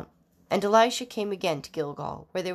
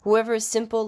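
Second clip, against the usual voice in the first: a reader's restrained call, raised and louder; mild calling out; 4 semitones higher, 6 dB louder than usual. A narrator's reading. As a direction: lift it up as a measured public summons. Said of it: "to call from the highest places".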